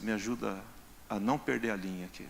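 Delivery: usual speaking voice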